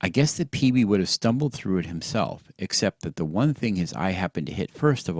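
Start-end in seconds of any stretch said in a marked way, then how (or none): none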